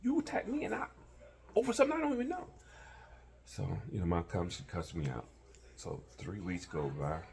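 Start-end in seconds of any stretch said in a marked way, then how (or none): 0.0-2.5 starts firm, ends in a much higher, louder yell; rising from firm to a shout at her; building yell. Retold or imitated. Man yelling back at crying girlfriend, building to a shout.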